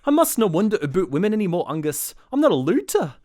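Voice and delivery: loud, high hammed-up Scottish; Scottish stage accent. Actor recalling his reply in the musical, mock Scottish. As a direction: declaim it in a bad Scottish brogue. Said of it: "And then I had to say".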